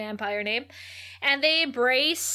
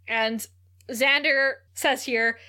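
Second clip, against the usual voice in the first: normal talking voice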